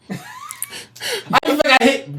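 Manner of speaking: high, slow, laughing through the words; laughing while talking. Real, not acted. Co-host laughing as he recalls switching up, high and slow.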